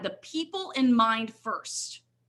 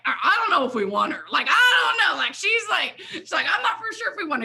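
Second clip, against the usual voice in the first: high, loud, laughing mock doubt; laughing imitation; 8 semitones higher, 5 dB louder, faster than usual. Owner Fred doubting whether to take her, laughing high imitation. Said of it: "I think Fred was like".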